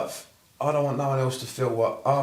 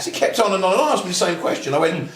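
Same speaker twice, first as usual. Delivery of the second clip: normal talking voice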